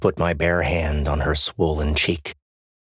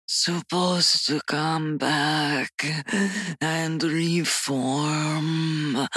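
very slow, high, drawn-out speech; weak, agonized moaning; 12 semitones higher, much slower than usual. A narrator's reading. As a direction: struggle through each word, moaning. Dying woman struggles to speak.

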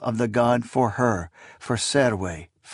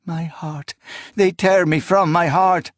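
higher, grieving voice; anguished lament; 5 semitones higher than usual. A narrator's reading. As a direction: lament as you are torn away. Woman torn from her beloved.

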